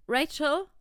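loud, high call over the shower noise. Acted. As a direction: shout her name over running water. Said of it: "übertönte seine Stimme auf einmal".

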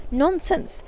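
the crazy prince scoffs, shouting it off; shouted. Acted. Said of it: "shouted the prince".

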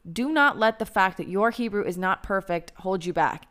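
ordinary delivery

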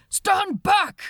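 loud, high commanding shout; barked order. A narrator's reading. Officer shouting at the mob to stand back. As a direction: bark it at the crowd to get back. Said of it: "ordered Second Officer Charles Lightoller".

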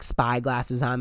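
usual speaking voice

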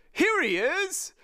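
high, slow drawn-out call; crying out. A narrator's reading. Crewman alerting others, high cry. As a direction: call it out across a deck. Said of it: "someone cried out".

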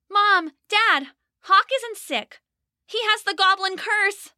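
put-on high young girl voice; an urgent little girl pleading; child's voice. Rose, a child, tells parents.

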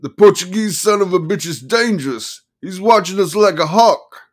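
high, loud manic lawyer; excited manic attorney. Acted. Attorney voiced, paranoid.

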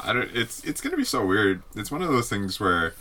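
ordinary delivery

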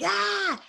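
high, stretched mock "aah"; frustrated outburst. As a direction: let out a mock cry of frustration. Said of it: "it's easy for me to be like".

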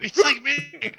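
high, loud, laughing through it; giggling disbelief. Real, not acted. Laughing at late-night energy drink.

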